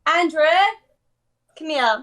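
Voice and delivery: rough imitation of a New York American accent; attempted American accent. Shown as a demonstration. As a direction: call out in a put-on American accent. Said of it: "let me hear his American accent".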